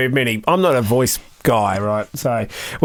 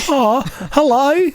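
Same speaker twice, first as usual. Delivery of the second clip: high, slow, baffled hello; a dopey dog dawning on you; confused dog voice; 10 semitones higher, slower than usual. Retold or imitated. Owner voicing his clumsy dog, high, slow and baffled. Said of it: "He's like".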